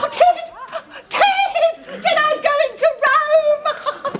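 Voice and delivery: high, loud delighted cries; thrilled squeal. Acted. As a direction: burst out with joy at winning. Mum wins trip to Rome.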